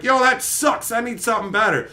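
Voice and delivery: high, petulant gripe; a touch of brattiness; mock whiny complaint. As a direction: sulk like a spoiled boss. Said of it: "the guy's like".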